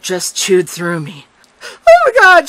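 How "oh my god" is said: high, loud panicked shout. A narrator's reading. Girl seeing narrator collapse, shouting high and loud. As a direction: cry out in alarm. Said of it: "shouted Missy".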